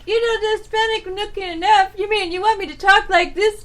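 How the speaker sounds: high-pitched, light attempt at a Mexican accent; mock Mexican accent. Joke teller voices rejected PBS applicant, mock Mexican accent. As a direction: imitate an indignant job seeker in a Mexican accent. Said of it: "you know, from Mexico".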